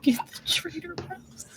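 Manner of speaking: hushed imitation of a shrill cry; whispered mock shout. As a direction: mimic a shout under your breath. Echoing the acted shout softly.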